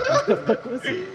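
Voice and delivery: high, laughing panic; panicked laugh. Real, not acted. Scared by noise.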